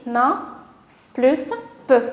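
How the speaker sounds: "na", pause, "plus pe" in separate pieces; "pe" as its own syllable, after a pause. Shown as a demonstration. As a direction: sound out "na plus pe" beat by beat. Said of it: "les syllabes qu'on va rassembler".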